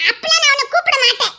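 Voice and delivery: usual speaking voice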